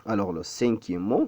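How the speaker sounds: usual speaking voice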